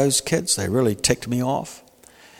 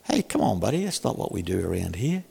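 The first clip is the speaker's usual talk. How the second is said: softer, kindly teacher to a pupil; gentle teacher; 4 semitones lower, 9 dB quieter, faster than usual. Retold or imitated. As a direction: coax the boy back calmly. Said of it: "I just look up and say".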